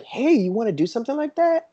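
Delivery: high, coaxing parent voice; parent to child. Retold or imitated. Parent coaxing their young kid at the booth, high and sing-song. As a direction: coax a little kid into it.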